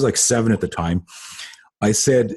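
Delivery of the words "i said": usual speaking voice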